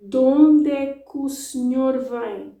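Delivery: De onde é que o senhor vem slow but linked. Shown as a demonstration. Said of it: "That's how I would say it, slower".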